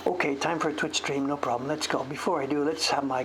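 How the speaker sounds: ordinary talk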